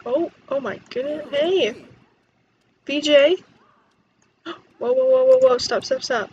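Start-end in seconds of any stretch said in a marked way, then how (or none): none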